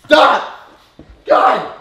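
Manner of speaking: loud, high exasperated shout; exasperated yell. Real, not acted. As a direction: yell at the prankster. Yelling at prankster.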